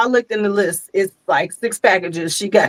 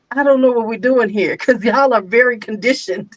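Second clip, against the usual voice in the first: ordinary talk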